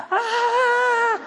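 high, shrill-free mock scream of riders. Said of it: "people are screaming at the top of their lungs".

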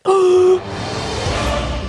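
big, high scary-movie gasp; mock shocked gasp. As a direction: gasp like a crowd in a horror film. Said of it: "everyone's like".

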